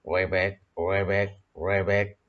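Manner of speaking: croaking like a frog. Shown as a demonstration. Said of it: "Bagaimana suara katak?".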